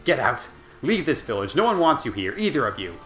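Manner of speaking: furious shout. A narrator's reading. Demo ordering Kaine and grandmother out, angry shout. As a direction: drive them out with a shout.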